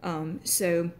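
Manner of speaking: ordinary delivery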